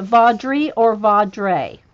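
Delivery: first Vaudrey ends -ree, second Vaudrey ends -ray; comparing pronunciations. Real, not acted. Host checking with the author, contrasting -ree and -ray endings. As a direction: weigh Vaudrey as VAWD-ree against Vaudrey as vawd-RAY. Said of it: "I'm gonna write it down".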